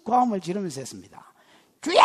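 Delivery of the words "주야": 크게 외치는 기도; "주야!"를 외치듯 부르기. Retold or imitated. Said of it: "이런 식으로 기도를 했습니다". (고함지르며 기도하는 흉내)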